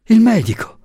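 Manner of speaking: high, gasped urgent call; desperate high cry; panting, urgent. A narrator's reading. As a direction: call for help, out of breath. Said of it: "diss'ella ansando".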